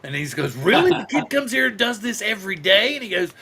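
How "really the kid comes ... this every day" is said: high, incredulous retold question; acted disbelief. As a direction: jump up loud on the first word, imitating disbelief. Acted incredulous reply in retold story.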